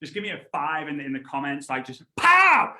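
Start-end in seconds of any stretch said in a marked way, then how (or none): 2.2-2.7 long, high, punchy exclamation; loud burst. Shown as a demonstration. Showing viewers what to post, exploding on the word. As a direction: throw it out loud and drawn out.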